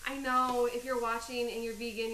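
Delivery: ordinary talk, nothing marked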